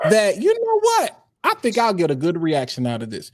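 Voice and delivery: ordinary talk, nothing marked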